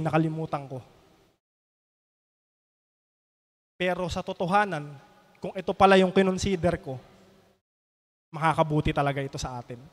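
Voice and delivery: humble the voice to beg forgiveness; remorseful apology. Acted. King apologizes to his jailed best friend, softly and remorsefully.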